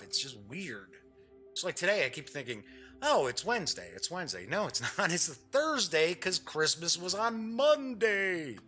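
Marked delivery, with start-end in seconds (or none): none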